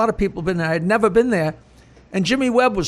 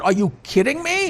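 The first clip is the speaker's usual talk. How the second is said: excited disbelief; 7 semitones higher than usual. Retold or imitated. Retelling his excited reply.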